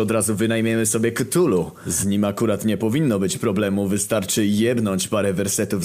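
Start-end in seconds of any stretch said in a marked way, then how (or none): none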